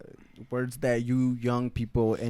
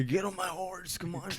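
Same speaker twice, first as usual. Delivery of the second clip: put-on very old man; 4 semitones higher, 8 dB quieter than usual. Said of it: "Hence the old man voice".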